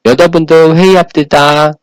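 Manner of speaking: 여자분들 with 러 slurred to 자, tongue lazy; 여자분들 회의합시다 said with a short tongue, s sounds mushy; lisping slushy speech. Shown as a demonstration. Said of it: "혀가 짧은 소리가 나요".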